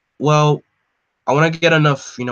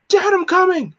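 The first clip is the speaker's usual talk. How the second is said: high frantic cry for his father; panicked shout; 6 dB louder than usual. Acted. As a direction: call out to Dad in terror. Tim rushing to his father.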